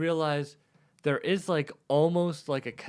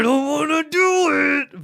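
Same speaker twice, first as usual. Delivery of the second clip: high, loud whiny grumble; whiny reluctance; 13 semitones higher, 7 dB louder than usual. Retold or imitated. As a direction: whine it like a reluctant kid. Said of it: "I'm like".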